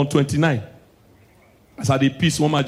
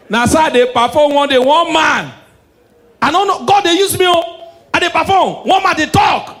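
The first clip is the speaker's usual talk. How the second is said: high, loud hammed-up prophecy; loud mock church prophet voice; mock prophet shouting; 9 semitones higher, 4 dB louder than usual. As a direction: ham up a shouting pastor. Prophet act at show, shouting.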